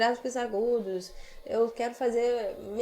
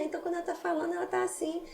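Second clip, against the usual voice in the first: much higher and softer, a thin little voice; thin voice demo; 7 semitones higher, 5 dB quieter, much faster than usual. Shown as a demonstration. Coach showing a student what a thin voice sounds like. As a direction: squeeze it up into a tiny voice. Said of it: "a voz bem fininha".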